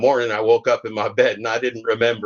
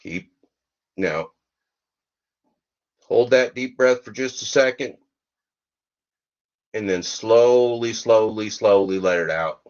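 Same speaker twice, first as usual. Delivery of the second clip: guided meditation; 2 semitones higher than usual. Acted. Leads a mock breathing meditation.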